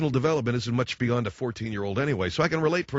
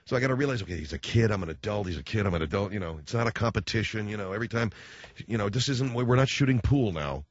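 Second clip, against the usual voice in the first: ordinary talk, nothing marked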